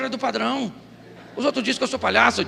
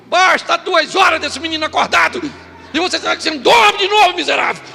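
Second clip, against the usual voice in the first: preaching shout; 6 semitones higher, 7 dB louder than usual. Acted. Preacher shouting at the congregation about money. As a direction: hammer it at the congregation.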